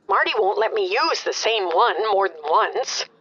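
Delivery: normal talking voice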